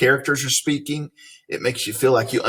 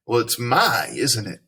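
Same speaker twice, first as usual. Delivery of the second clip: usual speaking voice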